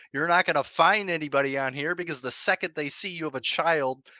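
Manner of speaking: ordinary talk, nothing marked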